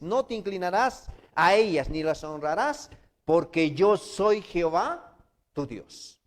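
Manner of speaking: slow, loud, high proclamation; loud, slow preaching. Acted. Preacher proclaiming the commandment slowly to the congregation. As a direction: hammer each word of the commandment. Repeats commandment even louder.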